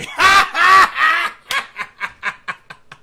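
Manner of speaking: loud high burst of laughter. Real, not acted. Laughing fit.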